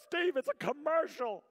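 high, loud mock scolding; hammed-up spousal exasperation; imitating exasperated wife. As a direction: put on a wife's high-pitched protest. Wife's complaint, acted in retelling.

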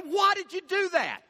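high, indignant demand, mildly acted; mock outrage at the careless driver; retold angry question. Woman in Lexus confronts young driver, indignant. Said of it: "she got angry".

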